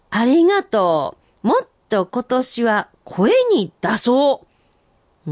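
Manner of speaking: senryu recital. Acted. Reading senryu aloud.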